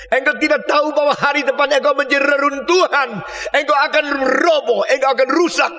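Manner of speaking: Jesus lamenting. Acted. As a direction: plead with the city as Jesus. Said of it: "Yesus Kristus berkata". (ratapan Yesus dengan suara tinggi dan keras)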